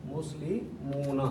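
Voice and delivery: مسلمون drawn out slowly, ending un stretched; slow demo. Shown as a demonstration. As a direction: say مسلمون syllable by syllable. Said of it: "اس واو کے ساتھ یہ مل جائے گا". (مسلمون آہستہ کھینچ کر)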